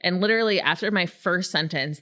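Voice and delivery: ordinary delivery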